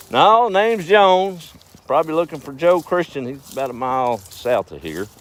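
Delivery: put-on old farmer voice. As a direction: play the unbothered farmer, still working.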